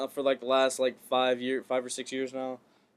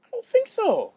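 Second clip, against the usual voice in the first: incredulous; 4 dB louder than usual. Real, not acted. Disagreeing with co-host.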